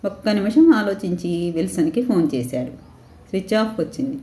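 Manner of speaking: ordinary delivery, nothing marked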